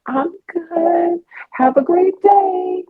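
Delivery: put-on high, chirpy little girl greeting. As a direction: squeak it out like a four-year-old.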